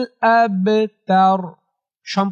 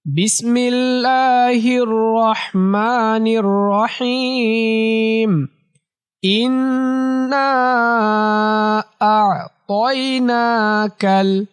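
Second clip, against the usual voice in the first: slow melodic tajweed chant; measured chanting recitation; Quran recitation; 3 semitones higher, much slower than usual. Shown as a demonstration. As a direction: draw out every long vowel in recitation. Teacher begins reciting the sura.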